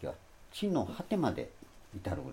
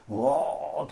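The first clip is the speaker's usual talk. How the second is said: a higher, louder hammed-up roar; mock roar; 6 semitones higher, 7 dB louder, much slower than usual. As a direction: roar like a beast to mock the translation. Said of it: "とかいうふうに".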